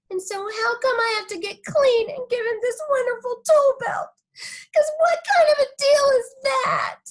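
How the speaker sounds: put-on high, whiny kid voice near tears; whiny little girl. Little girl whining to the listener about the party.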